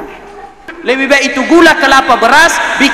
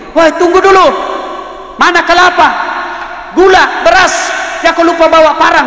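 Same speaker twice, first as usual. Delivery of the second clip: mock shouting mourner; 8 semitones higher than usual. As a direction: yell after someone who forgot the supplies. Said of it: "teriak nih". (teriakan pelayat yang dibuat-buat, tinggi dan keras)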